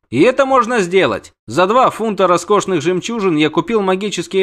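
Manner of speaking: high-voiced determined prince; insistent prince. Prince orders the witcher to translate his offer, insistent.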